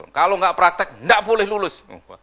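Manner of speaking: usual speaking voice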